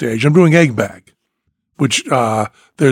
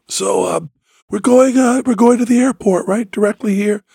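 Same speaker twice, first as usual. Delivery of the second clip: pitched up, slightly halting question; altered higher voice; 5 semitones higher than usual. Acted. Passenger with changed voice checking with limo driver, higher. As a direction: ask it in an unnaturally raised voice. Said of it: "my voice has also changed".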